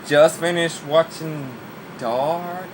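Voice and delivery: slow, stretched-out odd voice; odd drawn-out delivery. Real, not acted. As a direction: drag the words out strangely. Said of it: "I don't know why I said it like that".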